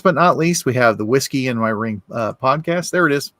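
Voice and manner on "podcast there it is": ordinary talk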